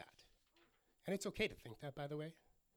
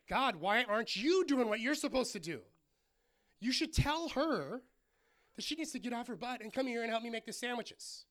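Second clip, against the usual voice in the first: imitated indignant complaint, raised pitch; louder, higher mock exasperation, demanding action; mad at God; 6 semitones higher, 5 dB louder than usual. Martha complaining to God about her sister, acted mad. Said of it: "she gets mad at God".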